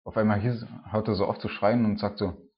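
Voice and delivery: ordinary talk, nothing marked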